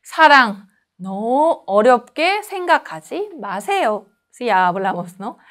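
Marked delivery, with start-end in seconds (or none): none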